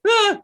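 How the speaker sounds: short, high mock scream; loud little shriek of fright; startled scream. Game master as a scared kid before running off, startled scream.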